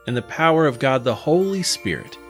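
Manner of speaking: usual speaking voice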